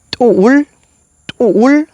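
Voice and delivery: «tuul» with a glottal catch on the T and a long vowel; glottalized T. Shown as a demonstration. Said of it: "el sonido de la T glotalizada suena". («tuul» con t glotalizada, más agudo)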